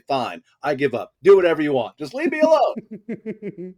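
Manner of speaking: hammed-up surrender, climbing into a wail; mock exasperation. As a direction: act out being driven round the twist. Voices people driven to despair.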